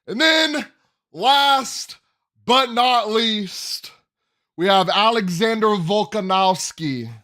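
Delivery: put-on high, loud announcer-style Russian accent; mock Russian accent. Vlogger announcing the main event in a Russian accent. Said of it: "I said that with some kind of Russian accent".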